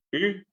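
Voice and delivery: Y said through the mouth, short and open; Y oral. Shown as a demonstration. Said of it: "pronuncian en forma oral". (Y oral, por la boca)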